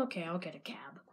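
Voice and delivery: dejected whisper to himself. Acted. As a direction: breathe it out, sulking. Disappointed whisper aside.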